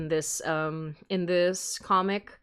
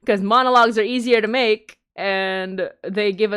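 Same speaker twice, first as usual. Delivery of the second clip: laughing; 4 semitones higher, 8 dB louder than usual. Real, not acted. Laughing at own big-episode joke.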